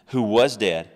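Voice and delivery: loud declared phrase; booming proclamation. Acted. Preacher proclaiming Jesus's words, booming. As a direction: boom it out like a pulpit climax. Preacher booms scripture quote.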